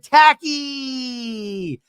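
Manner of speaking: high, drawn-out chant; mock group cheese shout; group photo cheer. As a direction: shout it like the whole group on cue. Group answers photo cue.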